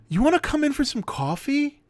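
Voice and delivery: high, coaxing mock girl voice; lightly voiced tipsy passenger making an offer. Drunk passenger invites driver in.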